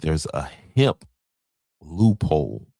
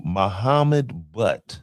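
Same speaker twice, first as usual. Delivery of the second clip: slow careful name; 2 semitones higher, much slower than usual. Shown as a demonstration. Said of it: "I don't wanna misname you".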